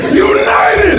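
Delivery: screamed football chant, very high and loud; fan's shout. Acted. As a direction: roar it like a rowdy stadium fan. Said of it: "They're like".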